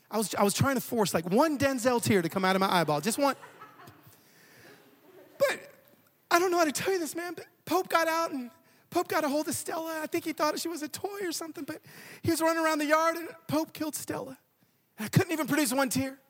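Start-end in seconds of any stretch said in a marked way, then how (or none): 5.4-14.4 high-pitched attempt at sounding sad; mock sorrowful confession. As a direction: break bad news with badly faked sorrow. Acted confession while faking sadness.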